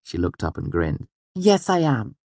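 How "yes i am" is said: put-on very high, pleased girl character; high-pitched little boast; proud Auri. A narrator's reading. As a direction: announce it proudly in a high girlish voice. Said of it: "she said proudly".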